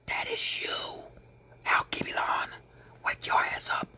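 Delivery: fierce hushed whisper; urgent whisper. Real, not acted. Preacher urging listeners to wake up, whispered.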